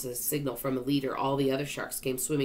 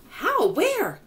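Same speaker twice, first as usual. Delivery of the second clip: very high, loud surprise; incredulous high cry; astonished questions; 8 dB louder than usual. A narrator's reading. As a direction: blurt out in amazement. Astonished question at good news.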